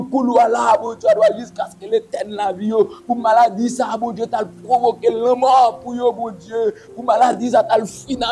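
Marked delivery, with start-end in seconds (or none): none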